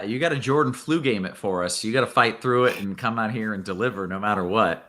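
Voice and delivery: ordinary talk, nothing marked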